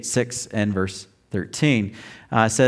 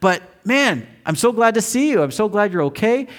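relieved excited; 9 semitones higher than usual. Retold or imitated. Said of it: "and I said".

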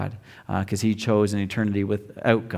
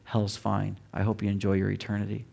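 bitter soft aside; 5 semitones lower, 9 dB quieter than usual. Retold or imitated. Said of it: "give him a pass to say".

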